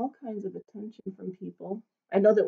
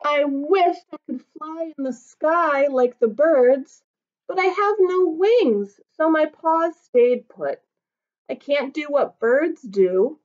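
higher, small wistful voice of a tiny dog; little dog Norbert, wistful; 6 semitones higher, 5 dB louder, slower than usual. Acted. Tiny dog wishing he were big, higher and wistful. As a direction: sound small and longing.

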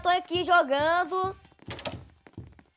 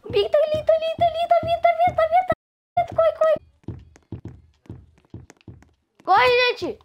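high loud frantic warning; being chased; 13 semitones higher, 5 dB louder, faster than usual. Real, not acted. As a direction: yell a panicked alert, over and over. Pig approaching, player fleeing.

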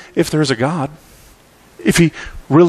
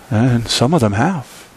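normal talking voice

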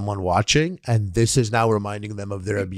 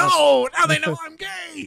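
much higher, slow mock-panicked whine; slower than usual. Streamer joking to co-hosts about what the viewers now know, high slow mock panic. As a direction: squeal it out slowly like a hammed-up panic. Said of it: "And I'm like".

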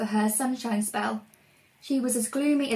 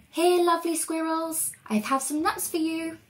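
higher, sweet-talking lure; coaxing call; 6 semitones higher than usual. Acted. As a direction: tempt the squirrels closer. Luring squirrels with nuts.

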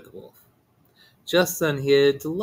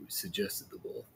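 ordinary delivery, nothing marked

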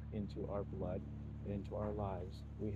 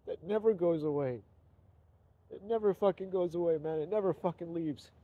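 higher and louder, voice straining with real emotion; real emotional outburst; 6 semitones higher, 6 dB louder than usual. Real, not acted. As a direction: let the pain pour out, pitched up and loud. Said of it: "I'm sorry to be so emotional".

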